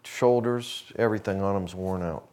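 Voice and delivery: usual speaking voice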